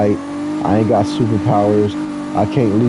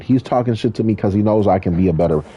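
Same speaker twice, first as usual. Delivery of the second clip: normal talking voice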